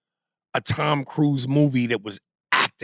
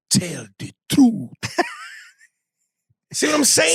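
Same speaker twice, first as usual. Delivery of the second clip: mock African accent. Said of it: "where he plays the, uh, the African".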